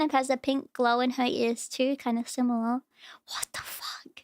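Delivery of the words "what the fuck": breathless whispered curse; shocked whisper. Real, not acted. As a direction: drop to a stunned whisper. Shocked by a video.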